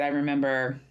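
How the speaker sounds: ordinary talk, nothing marked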